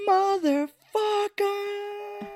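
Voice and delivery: very high, drawn-out curse; mock outraged howl. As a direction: howl it slowly, hammed-up and high-pitched. Retells character's outraged curse.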